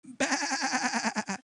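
high, quavering bleat; sheep bleat. Acted. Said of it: "You see little sheep".

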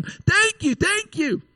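high, gushing mock-excited thank yous. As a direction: gush like someone handed brand-new car keys. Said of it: "jump up and down and be excited and say".

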